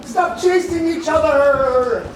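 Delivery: high, loud calling out; shouting. Acted. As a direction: yell at the pets to stop. Shouting at chasing pets.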